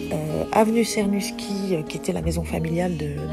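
normal talking voice

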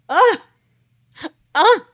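high, loud re-enacted gasp; tearful gasp. Reacting to dad's surprise letter, overcome gasps.

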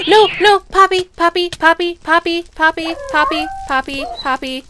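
panicked owner yelling at her dog; frantic calling out. Real, not acted. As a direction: shout the dog's name to stop her. Calling dog away from cat.

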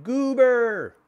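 high, loud hooting cry. Acted. Pigeons chasing the runner, hooting loudly. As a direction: hoot it out like a chasing bird. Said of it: "they hooted".

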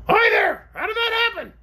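very high shouted creature voice; crying-out orc. A narrator's reading. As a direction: call up from below as a startled orc. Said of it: "cried the orc".

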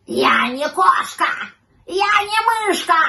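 slow, loud outraged shout; drawn-out indignant yell; indignant cat yelling. A narrator's reading. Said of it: "закричал возмущённый кот".

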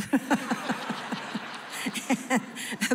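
airy, silent laugh; breathy laugh. Real, not acted. Laughing at her own joke.

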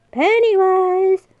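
drawn-out high call across the maze. Real, not acted. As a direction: call him out slowly from afar. Calling out to hidden clown.

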